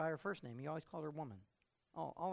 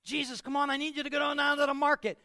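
high, loud insistent mom; mother raising her voice at her son; exasperated mother; 11 semitones higher, 10 dB louder than usual. Acted. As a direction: push him out the door, fed up.

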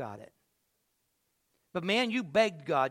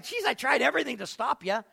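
raised, higher voice of exasperated protest; mock exasperation; 6 semitones higher, 6 dB louder than usual. Preacher voices God answering the believer, loud mock exasperation. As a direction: throw it back in playful disbelief. Acts out God's exasperated reply.

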